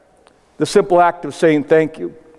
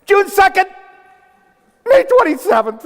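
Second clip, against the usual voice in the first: put-on high eager kids calling out dates; imitated kids shouting; 11 semitones higher than usual. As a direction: mimic seniors shouting their graduation days, pitched up. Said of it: "they'll shout out the day".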